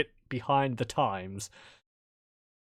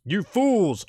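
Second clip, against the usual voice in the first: loud, higher scolding cry of a dragon; booming dragon rebuke; 7 semitones higher, 6 dB louder than usual. Acted. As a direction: scold the fools like a great dragon. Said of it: "in a booming voice that says".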